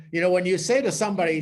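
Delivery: ordinary talk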